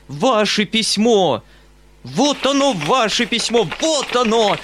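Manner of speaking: high, ranting outburst; flaring into fury. A narrator's reading. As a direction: explode and hammer out each exclamation. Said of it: "мгновенно воспламеняясь".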